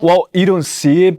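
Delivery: normal talking voice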